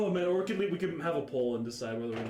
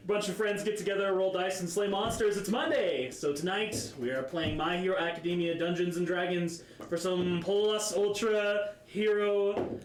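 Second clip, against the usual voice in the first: upbeat, raised show-opening voice; podcast host intro; 5 semitones higher than usual. Acted. Host opening D and D House for listeners, intro voice. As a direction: welcome listeners with an announcer's lift.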